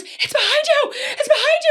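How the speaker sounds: high, loud retold panic with laughter; panicked shout. Friend warns the birthday girl in the attic, panicked shout. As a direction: shout a mock-frantic warning.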